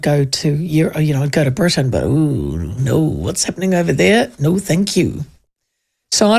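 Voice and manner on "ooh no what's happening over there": usual speaking voice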